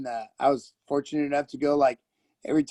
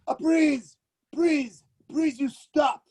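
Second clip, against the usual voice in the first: put-on high, pleading Japanese-accented English; pitched-up Japanese-accented plea; mock Japanese accent; 8 semitones higher, slower than usual. Mimicking Japanese onlookers pleading "please.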